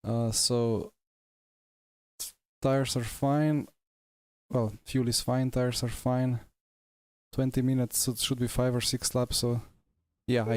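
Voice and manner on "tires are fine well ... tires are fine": usual speaking voice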